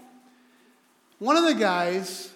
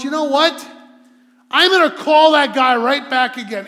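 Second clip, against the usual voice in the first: loud, higher, emphatic declaration; determined resolve; 6 semitones higher, 12 dB louder than usual. Retold or imitated. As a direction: voice his resolve forcefully, imitating the caller. Caller's determined resolve acted out.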